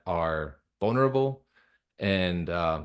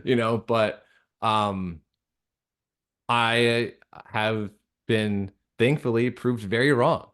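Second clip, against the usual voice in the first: ordinary talk, nothing marked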